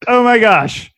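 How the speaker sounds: a light flustered squeal; mock flustered. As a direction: gush it like you're flustered. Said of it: "you're flustered".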